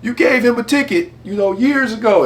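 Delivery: high, loud teasing holler; yelling over. Retold or imitated. Party guest calling out Earl about an old ticket, yelling over. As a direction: shout it across to a friend. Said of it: "yelled over to him".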